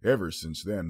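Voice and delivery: ordinary delivery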